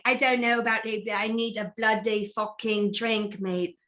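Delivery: mock mixed British accent, quick; lightly hammed-up British bloke; tour of British accents. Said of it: "that's a tour of accents you did".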